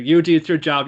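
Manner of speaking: a teammate barking the assignment at Yuji; mock order. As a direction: play the teammate handing off the job.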